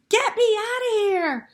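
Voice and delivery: shrieking high and loud; terrified high scream. A narrator's reading. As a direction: scream it in panic as the witch. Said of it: "she was screaming".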